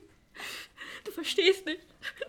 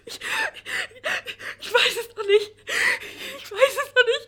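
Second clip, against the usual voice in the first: louder, higher sob-laugh; crying; 7 semitones higher, 4 dB louder than usual. Acted. Charlotte sobbing to the producer. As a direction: break down as you answer.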